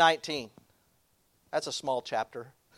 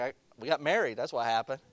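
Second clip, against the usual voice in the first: lower, soft deadpan comeback; deadpan husband; 5 semitones lower, 7 dB quieter than usual. Retold or imitated. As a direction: drop the punchline dry and low. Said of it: "I was like".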